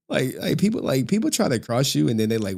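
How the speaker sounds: normal talking voice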